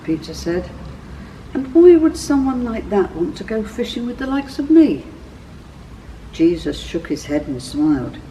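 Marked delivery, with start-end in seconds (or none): 1.5-5.0 higher, louder, overwhelmed asking; overwhelmed fisherman who feels unworthy; awed disbelief. A narrator's reading. Peter kneels trembling, overwhelmed.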